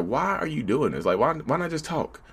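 soft pleading conscience asking; pleading inner voice. Retold or imitated. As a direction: plead softly with yourself. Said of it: "my mind is just like".

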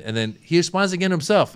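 normal talking voice

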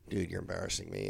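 muttered annoyance. Retold or imitated. Dad annoyed at son.

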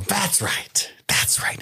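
whispered approval; hushed confirmation. Real, not acted. Host confirming the actor's name to a friend, whispered. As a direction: whisper it with mock intimacy.

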